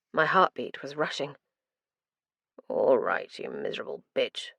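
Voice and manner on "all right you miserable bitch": low, breathy, almost whispered curse; muttered curse. A narrator's reading. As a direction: spit it under the breath, grim. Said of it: "Max muttered".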